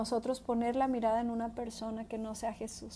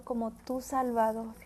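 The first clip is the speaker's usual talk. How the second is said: ordinary talk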